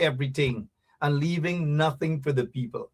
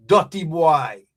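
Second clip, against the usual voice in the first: ordinary talk, nothing marked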